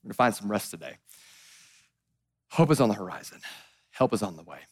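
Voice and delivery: low, soft and comforting; quiet reassurance. Real, not acted. Preacher promising rest to a tired congregation, low gentle reassurance.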